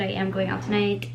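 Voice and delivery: ordinary talk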